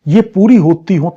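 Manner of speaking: ordinary talk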